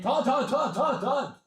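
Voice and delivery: high, loud sportscaster chant of a name; Mel Kiper. Retold or imitated. Breaker calling out a name as Mel Kiper, high and loud. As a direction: rattle off the name like a draft analyst. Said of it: "That's my Mel Kiper impression".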